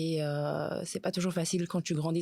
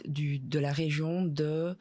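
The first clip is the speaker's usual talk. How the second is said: ordinary talk, nothing marked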